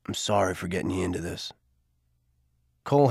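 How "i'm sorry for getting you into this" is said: low, soft, quick apology; Garvey voice. A narrator's reading. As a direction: lower and quieter older man.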